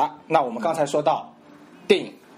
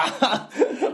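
高声笑; 12 semitones higher than usual. Real, not acted. 说到三级片笑.

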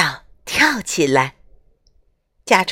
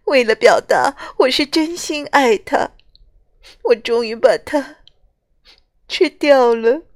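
tearful confession; 5 semitones higher than usual. Acted. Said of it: "螳螂姑娘流着眼泪".

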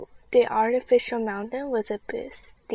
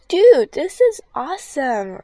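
loud, thrilled outburst; excited exclaim; 5 semitones higher, 12 dB louder than usual. Acted. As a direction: shout with delight. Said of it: "Rebecca exclaimed".

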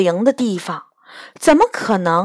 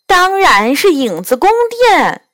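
mildly raised, emphatic retort; firm, slightly raised answer; louder retort; 6 semitones higher than usual. A narrator's reading. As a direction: insist on it as if obvious. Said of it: "狐狸更大声地说".

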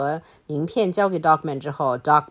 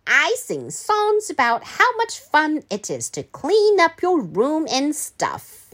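high-pitched mock British governess; pretend British accent; 9 semitones higher than usual. Governess boasts of her songs, mock British accent. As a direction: put on a prim British accent, higher. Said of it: "现在开始我就要假装British accent了".

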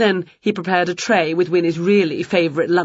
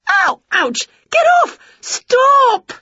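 very high, loud squeal of protest; pained shouting; 5 dB louder, slower than usual. A narrator's reading. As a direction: shout in alarm under a hail of blows. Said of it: "she shouted".

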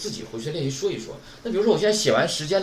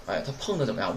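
normal talking voice

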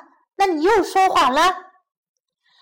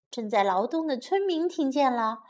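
plain reading voice

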